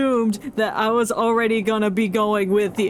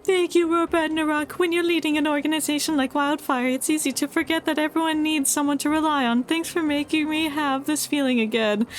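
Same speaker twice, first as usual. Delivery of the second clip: higher, polite character voice; voicing the NPC; 5 semitones higher, faster than usual. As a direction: read it as the gentle clinic doctor, pitched up. Reads NPC's lines aloud.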